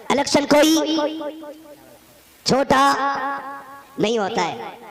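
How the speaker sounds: loud, with long pauses before چھوٹا; stress on چھوٹا. Acted. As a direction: hit چھوٹا hard after a dramatic pause. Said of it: "بتانا چاہ رہا ہوں".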